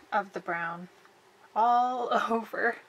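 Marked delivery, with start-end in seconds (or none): none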